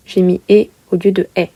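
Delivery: ordinary delivery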